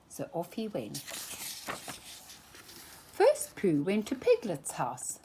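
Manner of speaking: plain reading voice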